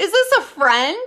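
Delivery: high, loud incredulous question; shocked disbelief. Real, not acted. Viewer suspects bird killed its friend, shocked disbelief. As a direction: pitch it up loud, aghast.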